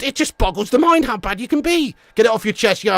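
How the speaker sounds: usual speaking voice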